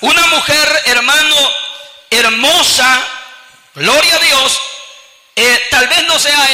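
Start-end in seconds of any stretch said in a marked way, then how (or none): none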